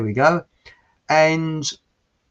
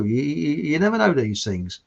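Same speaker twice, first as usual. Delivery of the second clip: usual speaking voice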